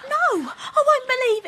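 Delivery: 1 indignant protest. Acted. Wife protesting against accusation.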